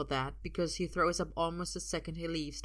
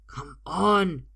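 loud, urgent push on the last word; impatient, desperate demand; 10 dB louder than usual. A narrator's reading. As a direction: urge him desperately. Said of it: "he sounds more desperate now".

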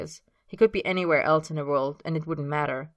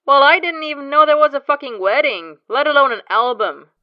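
exasperated outburst; 10 semitones higher, 13 dB louder than usual. Acted. James outraged.

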